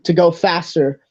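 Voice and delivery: ordinary talk, nothing marked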